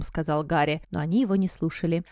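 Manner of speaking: usual speaking voice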